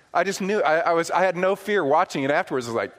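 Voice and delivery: ordinary talk, nothing marked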